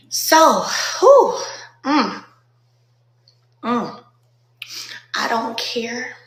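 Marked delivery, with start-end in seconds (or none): 0.1-4.0 much louder and higher burst of release; a loud, high exclamation of overwhelmed awe; overwhelmed whew. Real, not acted. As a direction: let the feeling burst out. Overwhelmed, exhaling the emotion.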